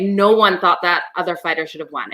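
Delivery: ordinary talk, nothing marked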